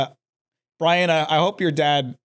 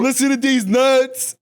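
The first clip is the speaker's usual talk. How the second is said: taunting; 5 semitones higher, slower than usual. Pretend. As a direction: drag it out to mock. Taunting joke, loud slow.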